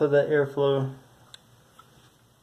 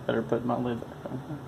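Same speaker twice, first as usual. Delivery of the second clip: mumbling; 8 dB quieter than usual. Real, not acted. Vlogger reminding himself to put the lid on, mumbling. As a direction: mumble it quietly, half to yourself. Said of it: "I'm mumbling".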